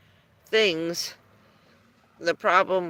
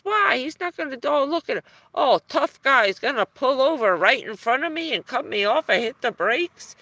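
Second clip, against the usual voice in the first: mock angry rant; 6 semitones higher, 4 dB louder than usual. Father's angry rant, retold.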